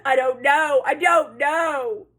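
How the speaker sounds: loud, high screaming for help; panicked scream. A narrator's reading. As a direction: scream it in panic, stretching the last cry. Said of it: "he began to scream into the microphone".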